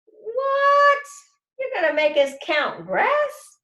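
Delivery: put-on high, loud shocked kid; outraged protest. A narrator's reading. Kid protests the grass-counting task, shocked and loud. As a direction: leap up in disbelief.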